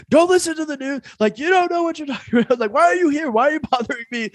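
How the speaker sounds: high-pitched imitation of screaming; mock screaming. Retelling a pharmacy worker flipping out at him, mock screaming.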